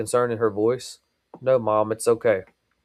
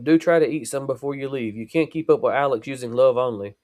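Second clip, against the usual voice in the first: ordinary delivery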